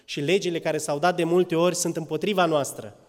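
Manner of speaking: normal talking voice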